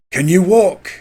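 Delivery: a hint of Angus asking in a Scottish accent; Scottish friend. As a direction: friend checks on the stoned man, lightly Scottish. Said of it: "says to him".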